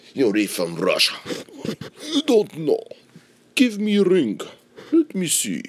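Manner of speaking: hammed-up Russian accent, high and loud. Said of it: "Trying to get my Russian accent all perfect".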